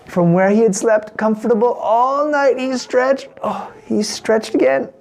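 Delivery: plain reading voice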